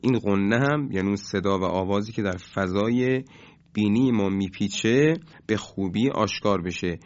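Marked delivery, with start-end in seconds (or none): none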